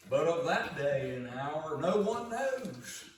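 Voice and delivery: high and loud, drawing out no one knows; ringing proclamation. Acted. Preacher voicing Jesus to the congregation, ringing proclamation. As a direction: proclaim it to the whole room.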